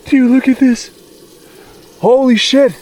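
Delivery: high, loud shocked outburst; stunned at a giant handprint. Real, not acted. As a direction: blurt it out in disbelief. Discovering a huge handprint.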